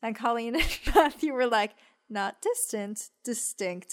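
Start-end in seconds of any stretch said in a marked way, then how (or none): 2.1-3.9 distinct set apart from distant, softly and slowly; quiet, deliberate correction with "distinct" said on its own. Retold or imitated. Correcting the lawyer's misread word, quiet and deliberate.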